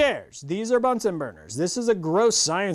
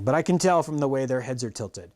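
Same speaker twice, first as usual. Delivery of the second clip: plain reading voice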